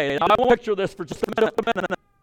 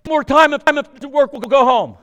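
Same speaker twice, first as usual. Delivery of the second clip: fed-up ultimatum; 5 semitones higher, 5 dB louder than usual. Retold or imitated. Naaman giving up, exasperated.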